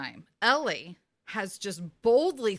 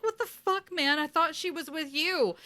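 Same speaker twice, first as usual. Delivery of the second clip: mock Sam, pitched way up; high indignant Sam; 9 semitones higher than usual. Host retells Sam's shock at the gun, voiced very high. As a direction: squeak out the outrage.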